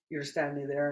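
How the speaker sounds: ordinary talk, nothing marked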